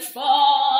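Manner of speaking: loud, mock dismayed curse; a hammed-up swear. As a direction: blurt it out in defeat. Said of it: "And you'll be like".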